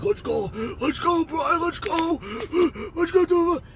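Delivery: high, frantic imitated urging; urgent hollering. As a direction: holler it repeatedly to rally a friend. Friend urging him to flee.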